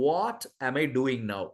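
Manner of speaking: ordinary talk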